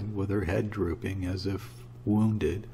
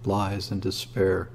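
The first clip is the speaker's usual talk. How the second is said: ordinary delivery, nothing marked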